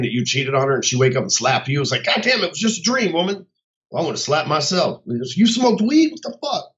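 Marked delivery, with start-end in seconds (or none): 2.0-3.5 higher, pleading God, it was only a dream; exasperated protest. Retold or imitated. Retelling reply to slapping wife. 5.1-6.7 much higher, slow, stunned yelling; mock outrage at himself. Scolding himself after dream.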